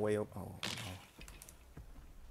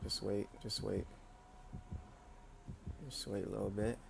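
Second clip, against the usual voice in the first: hushed; 10 dB quieter than usual. Real, not acted. Hiding from killer nearby.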